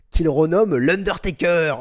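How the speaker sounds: ordinary delivery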